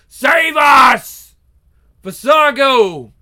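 desperate shout for help; loud. Acted. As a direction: scream it high and loud. Desperate cry for rescue.

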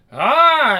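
high, loud drawn-out moan; acted moan. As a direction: let out a loud mock moan. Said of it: "her moans intensify".

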